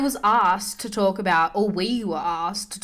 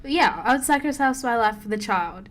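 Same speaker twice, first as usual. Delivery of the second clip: normal talking voice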